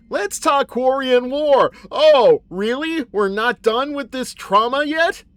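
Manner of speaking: loud, high, drawn-out protest; mock outrage. Mock-dramatic reaction to game topic.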